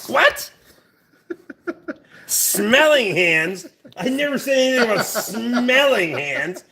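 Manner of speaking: drawn-out "smelling", rising in disbelief; incredulous denial. Real, not acted. Misquoted by a viewer about smelling hands, incredulous denial. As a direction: protest the misquote, stretching the key words.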